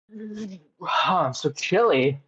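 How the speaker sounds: teeth chattering, shivering voice. Real, not acted. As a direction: shiver through the words. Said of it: "Teeth are chattering".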